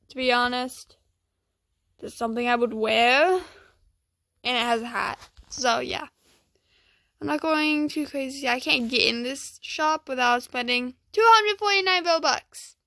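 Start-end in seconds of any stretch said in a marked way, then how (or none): none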